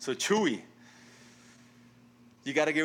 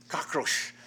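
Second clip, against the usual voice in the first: put-on Cuban accent; 2 semitones higher than usual.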